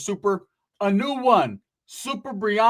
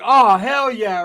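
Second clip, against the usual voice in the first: loud, slow drawn-out hell yeah; excited; 4 semitones higher, 7 dB louder, much slower than usual. Retold or imitated. Meeting the girl, loud slow thrilled. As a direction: relish the moment.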